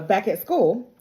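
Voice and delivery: ordinary delivery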